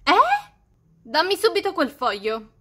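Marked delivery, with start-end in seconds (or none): 0.0-0.5 shocked eh. Real, not acted. Mother hears daughter's rude reply.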